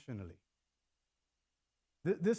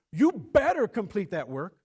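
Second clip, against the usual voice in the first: stern threat; 3 semitones higher, 9 dB louder than usual. Shown as a demonstration. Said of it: "it's intensified".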